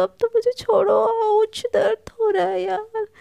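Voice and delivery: high, loud whine of acted pain; pained plea. Palak begging her friend to stop biting, pained whine. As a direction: plead through the hurt, drawn into a wail.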